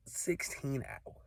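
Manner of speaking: breathy, exasperated under-breath repeat; hushed disbelief. Real, not acted. Exasperated traveler muttering to the camera, hushed disbelief. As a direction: mutter it like you can't believe it.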